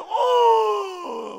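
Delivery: loud, high wail; a proud man breaking into loud weeping; wailing cry. Retold or imitated. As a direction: let out one sudden wail. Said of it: "우는 거예요".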